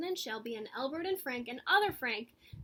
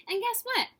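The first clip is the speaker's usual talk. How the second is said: much higher and louder question; bright teasing question; excited; 9 semitones higher, 7 dB louder than usual. A narrator's reading. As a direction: tease the kids with delight. Teaser to children.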